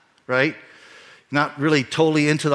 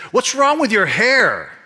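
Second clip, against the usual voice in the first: high, loud mocking kid voice; taunting kid; 11 semitones higher, 8 dB louder, slower than usual. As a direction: jeer at the new boy like a schoolyard bully. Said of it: "one kid looks up and he sees me and says".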